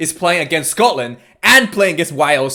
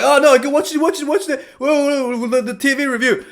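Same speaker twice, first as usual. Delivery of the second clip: protesting French players; 9 semitones higher than usual. Retold or imitated. French players beg the referee for the TV review, high and pleading. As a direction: beg the ref to go watch it. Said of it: "They were like".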